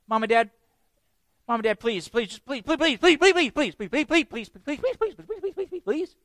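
put-on high, rapid-fire kid pleading; begging kid. Kids pestering mom and dad, begging.

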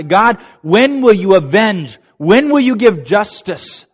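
impassioned high-pitched appeal; crying out. Retold or imitated. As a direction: cry out for justice, stretching the words. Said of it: "they're crying out and say".

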